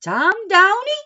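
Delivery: mock stern grandma calling out; scolding call. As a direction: call him out with a wagging finger. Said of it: "she's shaking her finger".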